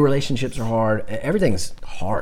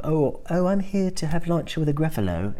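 higher-pitched British storybook mouse; British accent; 6 semitones higher than usual. Acted. Mouse telling animals about his lunch plans, British accent. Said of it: "it's in a British voice".

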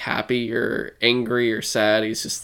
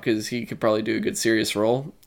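ordinary delivery

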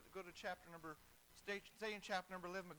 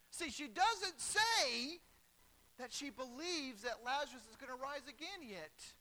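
ordinary talk, nothing marked

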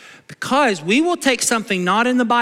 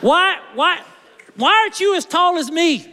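higher whiny shout of why; whining why; 7 semitones higher than usual. Acted. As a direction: whine the whys like a petty judge. Acted judge berating volunteer.

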